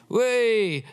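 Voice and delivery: excited whoop. Shown as a demonstration. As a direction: whoop like a thrilled listener. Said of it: "then please say amen".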